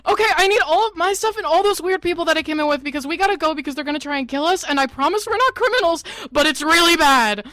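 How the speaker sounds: high, loud, frantic rush; frantic pleading. Acted. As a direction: plead desperately, talking fast. Pleading with Lynn for her stuff.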